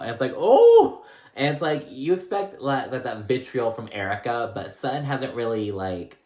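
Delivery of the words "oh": loud, high gasp of shock. Real, not acted. Vlogger reacting to Sutton cursing back, loud and high. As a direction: react like the gloves just came off.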